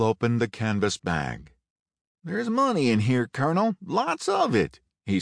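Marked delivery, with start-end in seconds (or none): 2.2-4.8 excited. A narrator's reading. Cosell finds money.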